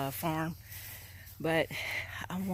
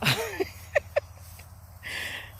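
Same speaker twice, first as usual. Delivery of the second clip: breathless laugh. Real, not acted.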